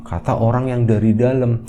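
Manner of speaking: ordinary delivery, nothing marked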